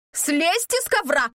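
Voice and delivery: sharp high yell. Acted. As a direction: yell it from the gate. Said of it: "завопила доцент Ойтка".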